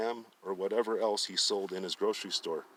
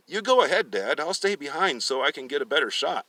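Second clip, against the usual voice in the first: louder, a bit higher eager reply; scheming son; 5 semitones higher, 7 dB louder than usual. Acted. Daniel sending his father ahead, loud and eager. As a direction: sound helpful while plotting.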